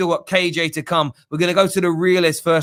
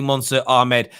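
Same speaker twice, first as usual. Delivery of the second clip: ordinary talk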